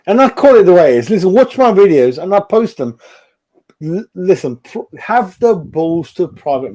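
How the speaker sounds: angry rant. Real, not acted. Fan ranting at viewers who doubt him, fast loud angry outburst.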